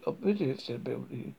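ordinary delivery, nothing marked

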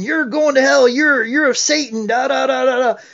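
high-pitched imitation of a yelling preacher; retold yell. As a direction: mimic a pastor yelling condemnation at the congregation. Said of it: "he yelled at me in front of the whole congregation".

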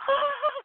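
short high squeal of panic; mock scream. Model shrieks after being told to hide fear. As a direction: let out a sudden high shriek. Told she can't be scared.

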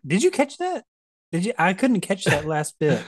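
high sly prying questions; suspicious Vanini. Retold or imitated. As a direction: fish for what they overheard.

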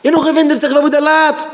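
high, loud preacher's shout; shouted scolding, pitched far up; full-force rebuke. Real, not acted. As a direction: cry out in reproach to the congregation.

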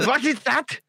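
high mock German accent, laughing; German-accented English. Imitating Germans speaking English, laughing in a mock German accent. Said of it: "Germans speak in English in their accent".

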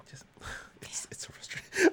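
frustrated whisper; hushed and fed up. Real, not acted.

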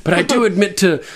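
half-acted Tyrion cracking up mid-quote; laughing retelling. Retelling Tyrion's mock confession at his trial, laughing. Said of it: "And he's like".

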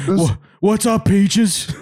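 imitated guy hailing his cat; funny cat-owner voice. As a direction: greet the cat in a high comic voice. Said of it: "his voice is so funny".

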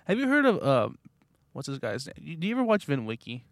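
ordinary talk, nothing marked

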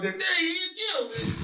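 high, slow eager shout; lightly voiced eager crowd; excited mob voice. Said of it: "be like".

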